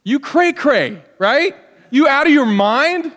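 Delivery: loud, high mock outrage at a half-hearted husband; wife scolding her husband, a hammed-up incredulous outburst; mock outraged wife. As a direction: throw it back at him, incredulous and loud. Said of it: "what should her appropriate response be".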